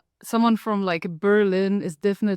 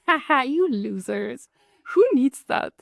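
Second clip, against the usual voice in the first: slow mocking laugh rising high on who needs that; smug teasing jab; mocking gloat; 4 semitones higher than usual. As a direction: gloat over the Germans, then squeak up. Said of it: "And I was like".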